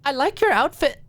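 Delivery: high, playful voiced friend; teasing. A narrator's reading. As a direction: tease her sweetly.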